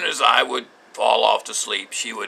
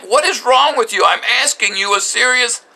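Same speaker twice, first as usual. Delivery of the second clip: high, loud exasperated outburst, slowing at the end; husband loses his temper demanding an answer from his wife; losing it; 10 semitones higher, 4 dB louder than usual. Retold or imitated. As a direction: plead in rising frustration at the wife. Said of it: "I start losing it".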